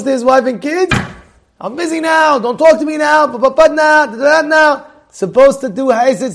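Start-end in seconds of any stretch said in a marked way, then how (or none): 1.6-4.9 high-pitched, harried mock dismissal; impatient brush-off. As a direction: wave the family away, trailing into nonsense syllables. Mimics husband brushing off his family.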